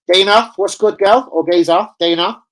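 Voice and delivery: loud, high hail to a viewer; excited greeting. Real, not acted. Streamer hailing viewer Dana in chat, loud and high. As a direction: call out to a friend in chat.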